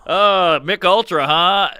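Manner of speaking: punched-up, pushy punchline voice; comic pitching a punchline. Retold or imitated. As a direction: sell it hard to a dead room. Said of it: "they mimic my bomb".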